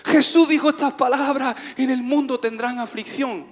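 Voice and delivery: loud, high sermon delivery; preaching. Real, not acted. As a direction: proclaim it to the congregation, drawing out key words. Preaches at full force. (predicación enérgica, voz alta y aguda)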